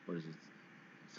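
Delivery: half-voiced whisper, thinking aloud; whispered aside. Real, not acted. Teacher whispering to herself, searching for a word. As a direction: whisper the question to yourself.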